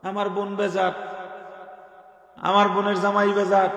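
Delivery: ordinary talk, nothing marked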